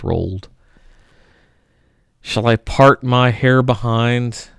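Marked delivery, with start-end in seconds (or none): none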